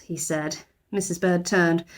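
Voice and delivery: plain reading voice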